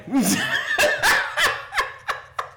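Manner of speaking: cackling at the Falcons; high shouting laugh. Real, not acted. Mocking the Falcons.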